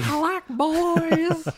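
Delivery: very high, slow creepy imitation; creepy mock voice. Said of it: "Just really creepy".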